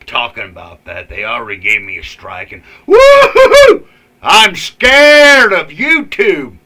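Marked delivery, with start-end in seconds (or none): 1.1-3.9 mocking woo-hoo-hoo. Mock fear of YouTube strike. 4.2-6.6 sarcastic fear. Pretend. Sarcastic after YouTube strike.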